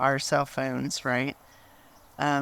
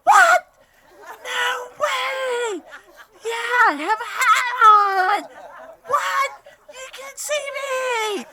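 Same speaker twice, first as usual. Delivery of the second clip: very high, loud laughing shout of disbelief; amazed shrieking Gordon; 6 dB louder, slower than usual. Retold or imitated. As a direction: squeal like a kid who just saw a magic trick. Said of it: "And he's like".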